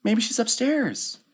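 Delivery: put-on very high child's voice; high-pitched childish voice; child's suggestion. A narrator's reading. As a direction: offer it like a small kid guessing. Child suggesting where Marion is.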